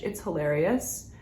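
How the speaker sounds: ordinary talk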